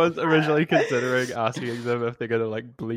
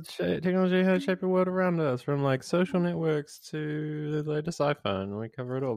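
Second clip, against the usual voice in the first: announcer voice; 4 semitones higher than usual. Shown as a demonstration. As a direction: read it like a podcast ad intro. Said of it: "it also sounds like".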